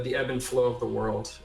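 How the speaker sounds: ordinary talk, nothing marked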